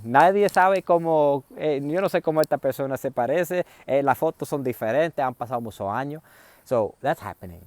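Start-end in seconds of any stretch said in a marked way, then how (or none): 0.0-6.2 high, loud, excited shouting across the station; loud calling out. Retold or imitated. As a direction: shout it over the crowd, searching.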